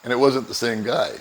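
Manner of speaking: usual speaking voice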